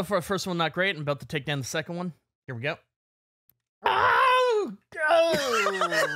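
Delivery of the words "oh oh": loud high pained yelp at the sour taste; pained sour groan. Real, not acted. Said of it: "That sounded like an Andrew Dice Clay impression".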